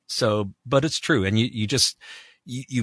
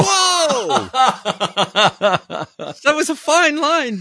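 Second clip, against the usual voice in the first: laughing exclamation; 6 dB louder, slower than usual. Real, not acted. Laughing at DNA result.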